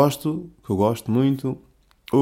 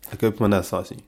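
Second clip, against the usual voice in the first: ordinary talk, nothing marked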